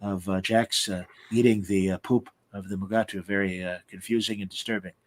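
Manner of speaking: put-on a weary old creator murmuring his disapproval; Gene Roddenberry. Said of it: "Majel and I had to".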